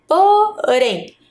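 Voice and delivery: "Porém" pulled apart into "Po" and "rém", pitched high; stress on RÉM. Shown as a demonstration. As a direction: sound out "Porém" to single out "rém". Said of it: "A sílaba rem". ("Porém" silabado, destacando o "rém")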